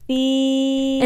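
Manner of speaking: mock beep. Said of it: "I'm like".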